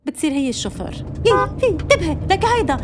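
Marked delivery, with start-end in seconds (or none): 1.2-2.8 much higher, louder startled yelps; alarmed mother passenger. Retold or imitated. Mother in the car warning the driver, startled high yelps. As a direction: yelp like a nervous backseat driver.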